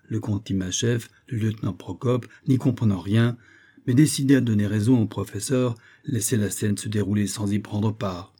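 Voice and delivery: ordinary delivery, nothing marked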